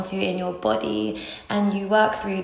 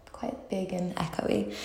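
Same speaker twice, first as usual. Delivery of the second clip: whispering; 9 dB quieter than usual. Real, not acted. Whispered aside about bathroom.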